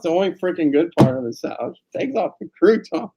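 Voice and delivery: ordinary delivery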